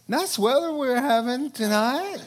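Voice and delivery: change the subject nervously; awkward deflection. Retold or imitated. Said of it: "you're like".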